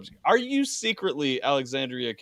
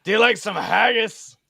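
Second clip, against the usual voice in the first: high-pitched mock Scottish accent; 6 semitones higher, 4 dB louder than usual. Teasing the friend whose accent seems almost Scottish, mock Scottish accent. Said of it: "it's almost Scottish".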